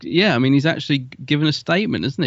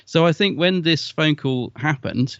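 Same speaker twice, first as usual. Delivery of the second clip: normal talking voice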